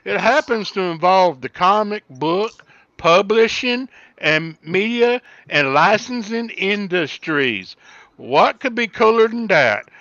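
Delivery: a hammed-up Southern gentleman accent. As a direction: read it in a thick Southern accent for her. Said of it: "Oh, he's real Southern right there".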